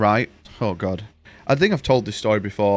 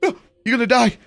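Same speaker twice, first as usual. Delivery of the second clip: high-pitched mock alarm, louder; panicking overprotective mum; 10 semitones higher, 4 dB louder than usual. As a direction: imitate a mum shrieking a warning. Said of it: "my mum is to the point where it's like".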